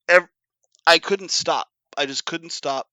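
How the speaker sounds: normal talking voice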